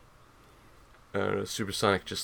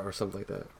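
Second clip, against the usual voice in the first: ordinary delivery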